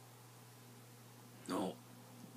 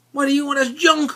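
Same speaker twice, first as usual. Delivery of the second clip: high, louder scoffing buddy; scoffing friend; 11 semitones higher, 7 dB louder, faster than usual. Retold or imitated. Buddy scoffs at the eighty dollar box, high and loud. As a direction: sneer that it's junk, pitched up.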